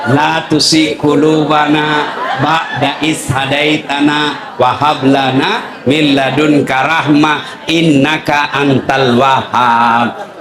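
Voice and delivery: slow, low chanted Quranic prayer; prayer recitation. Acted. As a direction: draw each word out like a prayer.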